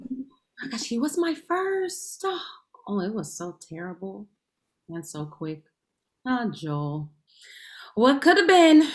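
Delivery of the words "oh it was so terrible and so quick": confiding. Acted. Woman confiding about her first to her friend, fading to quiet. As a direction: confess it softer and softer.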